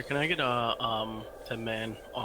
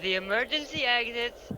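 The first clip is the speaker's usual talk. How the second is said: lightly voiced airline safety announcement; a hint of a stewardess reading the exits; flight attendant; 5 semitones higher than usual. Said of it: "What, with the accent, too?".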